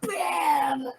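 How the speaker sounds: strained, tearful mock shriek; mock scream. Player riffing on the cursed bed over others' talk, mock scream. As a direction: wail it out in hammed-up horror.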